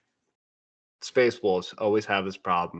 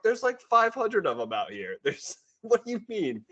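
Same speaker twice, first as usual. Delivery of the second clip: high, incredulous voice breaking into a laugh; much higher, laughing in disbelief; disbelieving; 13 semitones higher than usual. Retold or imitated. Raven Guard baffled by the Wolves' confidence, high and laughing.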